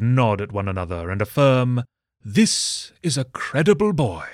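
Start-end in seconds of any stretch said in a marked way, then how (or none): 2.2-4.3 pompous priest. Acted. Priests affirm, voiced.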